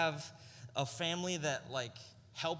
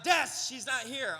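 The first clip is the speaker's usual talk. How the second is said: calling out; 7 semitones higher, 4 dB louder than usual. Retold or imitated. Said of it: "I'm like".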